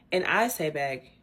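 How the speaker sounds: ordinary delivery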